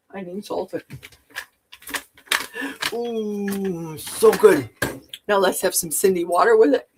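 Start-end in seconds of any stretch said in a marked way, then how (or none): 2.9-4.7 savoring a bite; tasting off spoon. Real, not acted. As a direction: mouth full, pleased. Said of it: "tasting reaction".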